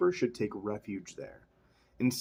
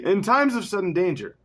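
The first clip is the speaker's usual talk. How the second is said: high, loud, theatrical announcing; hammed-up dramatic reading; 8 semitones higher, 7 dB louder than usual. Reading the line about sudden danger, hammed-up loud proclamation. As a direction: proclaim it at an exaggerated pitch. Retake after laughing fit.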